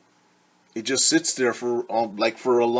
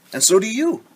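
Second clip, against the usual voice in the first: cheery; 11 semitones higher, 7 dB louder, faster than usual. Acted. Teacher's bright address to kids.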